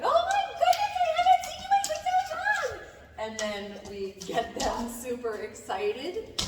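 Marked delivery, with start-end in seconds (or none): 0.0-2.8 squealing high and loud, gushing at the dog; overexcited greeting. Shown as a demonstration. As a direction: gush over the dog like you've missed him for ages. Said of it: "we rev them up and like".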